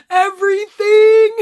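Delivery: tearful cry. Retold or imitated. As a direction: blurt it through retold tears. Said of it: "I'm sitting there crying".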